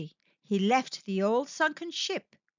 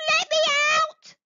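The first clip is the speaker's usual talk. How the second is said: trapped jellyfish; 7 dB louder than usual. Acted. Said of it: "said Jellyfish".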